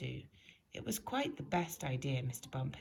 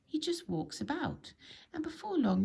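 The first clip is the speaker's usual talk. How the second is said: rising excitement; 10 semitones higher than usual. A narrator's reading. Building to the punchline.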